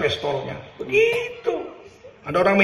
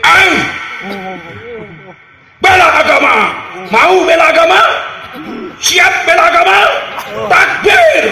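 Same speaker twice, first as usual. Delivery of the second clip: high, loud rally cry; fiery rally shout; 9 semitones higher, 9 dB louder than usual. Retold or imitated. Imitating Habib Rizieq rousing a crowd, loud shouting. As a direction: whip up the crowd like a firebrand. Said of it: "keras kayak Habib Rizik".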